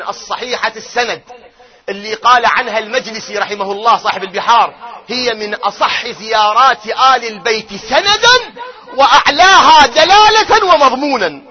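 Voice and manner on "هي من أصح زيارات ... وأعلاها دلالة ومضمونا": slow, loud and higher proclamation; emphatic proclamation. Acted. Preacher proclaiming Majlisi's praise of the ziyara, slow and loud. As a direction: declaim the quotation as a weighty verdict.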